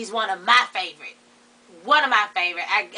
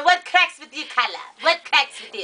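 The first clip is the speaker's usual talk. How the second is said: high-pitched mock African accent; African accent imitation; 5 semitones higher than usual. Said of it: "He's always saying".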